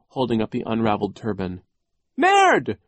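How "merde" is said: very high piercing shriek; baby shriek. A narrator's reading. Baby Sonny holding up the shoelace, shrill shriek. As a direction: squeal it like a tiny furious baby. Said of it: "Sonny shrieked".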